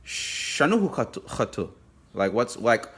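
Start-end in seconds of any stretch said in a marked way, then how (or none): 0.0-1.7 "Shanuhu khatu" said in Sabayan, higher; Sabayan word. Shown as a demonstration. Teacher demonstrating "Shanuhu khatu" to students. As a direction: say "Shanuhu khatu" with the native lilt. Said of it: "And, and you would say".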